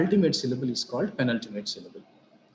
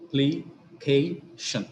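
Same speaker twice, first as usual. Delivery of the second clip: "Application" slowly split as a, pli, kay, shun; syllable by syllable; much slower than usual. Shown as a demonstration. Teacher dividing the next example, "Application" slowly in separate syllables. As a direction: pause between each syllable of "Application". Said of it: "shan is the ultimate syllable".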